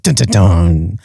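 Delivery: low dramatic "dun, dun, dun"; mock suspense sting. Podcaster teasing a death in the blurb, mock-ominous sting. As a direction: intone a cheesy low cliffhanger sting.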